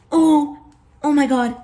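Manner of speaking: high, loud happy gush; delighted. Real, not acted. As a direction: gush over the smell. Smells the dough.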